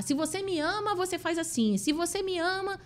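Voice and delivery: high, demanding "se você me ama"; playing the partner who sets conditions; mock controlling partner. (imitando a parceira controladora, aguda e exigente)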